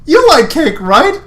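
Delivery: high, loud, pushy cheer; nervous Lance. Acted. Lance pressing the heroine about cake, high and loud.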